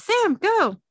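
loud high urgent call; yelling. Acted. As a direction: shout across the room. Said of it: "Dean yells".

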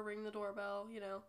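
usual speaking voice

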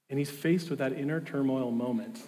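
normal talking voice

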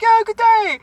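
high, loud mock Australian accent; mocking Aussie greeting; fake Australian. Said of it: "try and pretend, like, they go to Australia".

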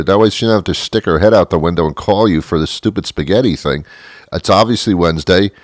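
ordinary talk

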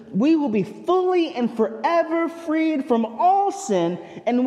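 fervent pulpit build; rising preaching. Acted. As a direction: lift the congregation with each phrase.

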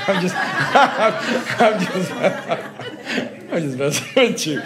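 chuckling through the words; laughing tease. Real, not acted. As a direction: laugh it off as a joke. Teasing congregation, laughing.

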